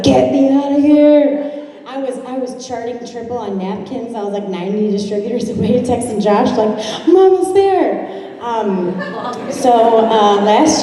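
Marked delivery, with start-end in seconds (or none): 0.0-1.7 slow, drawn-out whine; mock whiny plea. Filming herself on a miserable shift, slow whine. Bored on dinner break. 7.1-8.1 much higher, thrilled; excited update. Retold or imitated. Texting Josh she's nearly at the next rank, higher.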